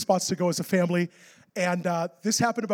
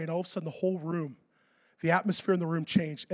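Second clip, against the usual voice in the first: ordinary delivery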